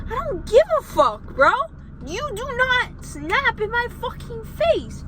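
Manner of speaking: high, loud outburst, slowing at the end; retold angry comeback. Telling the customer off at the counter, high furious retold rant. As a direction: replay your furious snap at him. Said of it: "I'm like".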